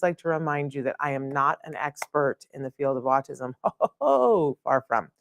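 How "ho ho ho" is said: a much higher ho ho ho laugh at herself; real self-deprecating laugh; self-mocking laugh. Real, not acted. Laughs at not being expert.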